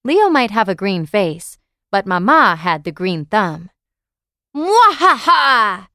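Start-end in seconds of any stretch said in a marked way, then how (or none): 4.5-5.9 slow, high screeching cackle; evil witch cackle. A narrator's reading. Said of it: "Leo screeched at Daddy".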